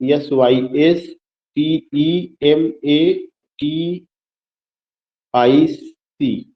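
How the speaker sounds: letter by letter, very slowly; slow spelling out. Real, not acted. Teacher spelling systematic for students, slow letter-by-letter dictation. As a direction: spell it for someone writing it down.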